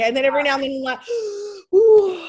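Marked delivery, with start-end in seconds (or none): 1.7-2.2 sharp high gasp into a loud ooh; gasp and ooh of pain. Shown as a demonstration. Showing the hairdresser her labour pains, gasping ooh. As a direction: catch your breath from a sudden pang. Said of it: "like this".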